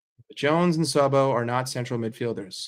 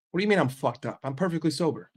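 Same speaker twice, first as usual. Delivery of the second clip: usual speaking voice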